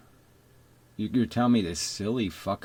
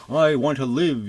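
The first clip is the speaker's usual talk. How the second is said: mock slogan; 5 semitones higher, 6 dB louder than usual. Mocking a forced slogan.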